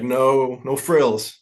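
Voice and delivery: ordinary talk, nothing marked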